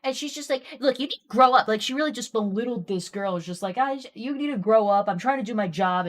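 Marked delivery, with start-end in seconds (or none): 0.8-1.7 louder, scolding nurse; mock scolding, much louder; imitating a harsh nurse. Retelling a rude nurse. 3.8-6.1 louder, impatient lecture; imitated nurse telling off a patient; mock scolding nurse. Retelling a rude nurse.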